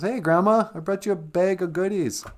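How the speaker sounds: put-on high, sweet girlish greeting; little girl voice. Little Red greeting the wolf in bed, high girlish voice. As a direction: chirp it like a cheerful little girl.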